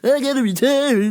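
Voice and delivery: high, loud frantic mock old customer; spazzing old man. Mocking the frantic customer demanding a return, high and loud. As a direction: squawk it like a panicky old man. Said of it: "So he's like".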